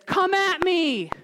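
high, drawn-out taunting challenge; taunting yell. Retold or imitated. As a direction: call out "come at me" like a taunting warrior. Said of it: "in his taunting and yelling".

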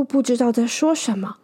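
ordinary delivery

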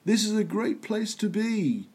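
lightly voiced Paul, pitched well up; a hint of [name] greeting the crowd, high; quoting Paul. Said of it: "Paul says".

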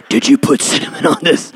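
unvoiced, hissed mock accusation; breathy mock accusation; whispered wife. Husband redoes his wife's accusation, in a hissed whisper. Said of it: "Don't let her fool you".

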